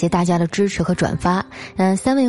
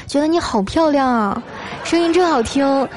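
high-pitched fan enthusiasm; gushing admiration; 7 semitones higher than usual. Acted. Host reading a listener's flattering message, gushing. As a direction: gush with a lifted, admiring voice.

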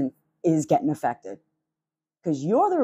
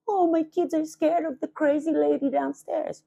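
much higher, mocking mimic of the neighbour; mocking the neighbour's voice; 10 semitones higher than usual. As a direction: parody her whining. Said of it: "you said".

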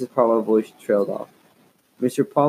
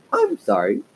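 much higher squeaky puppet voice; dummy voice. A narrator's reading. Girl making her dummy apologise, high puppet voice. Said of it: "she made the dummy say".

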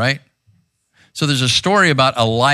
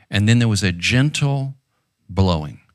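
low, gentle voice sinking at the end; 6 semitones lower than usual. Acted. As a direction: let it settle down gently. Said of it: "That's the voice that I believe".

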